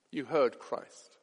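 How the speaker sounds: "heard" said low and hushed; soft, slow repeat. Acted. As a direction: let "You heard Christ" sink in quietly. Same words repeated softly.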